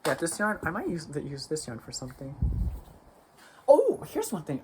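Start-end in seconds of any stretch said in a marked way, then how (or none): 3.7-4.6 surprised. Real, not acted. Finds the item she was looking for.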